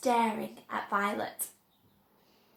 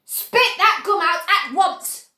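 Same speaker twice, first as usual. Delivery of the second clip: slow, loud commanding order; stern order; 5 semitones higher, 9 dB louder than usual. A narrator's reading. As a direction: command the daughter firmly. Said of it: "ordered Mister Beauregard".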